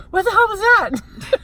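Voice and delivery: startled, high and loud; jolted awake and alarmed. Retold or imitated. Woken by a strange noise.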